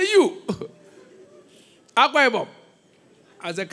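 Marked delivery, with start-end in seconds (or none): 0.0-2.5 high, startled disbelief; can't believe what you're hearing; mock shocked pastor; incredulous. Pastor retells disbelief at young man.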